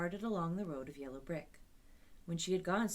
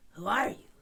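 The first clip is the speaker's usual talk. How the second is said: husky scarecrow; 3 semitones higher, 10 dB louder than usual. A narrator's reading. Scarecrow voiced in story.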